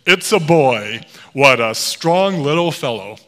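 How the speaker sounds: hearty, loud nurse dropping the voice deep; mock-deep, forceful announcement. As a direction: boom it out proudly, imitating a deep-voiced nurse. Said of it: "her voice dropped down really deep".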